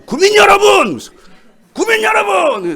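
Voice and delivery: high loud slow call; slow, loud call to the people; rabble-rousing shout. Shown as a demonstration. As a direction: stir up the crowd. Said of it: "선동하는 거야".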